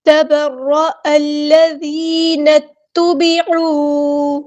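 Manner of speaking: تبر الذین اتبعوا slow, the hamza dropped and the ن joined to ت; joined reading. Shown as a demonstration. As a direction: link the words without the wasl hamza. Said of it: "نون کو ہم نے تا کے ساتھ ملا دینا". (حمزۃ الوصل گرا کر جوڑ کر پڑھیں)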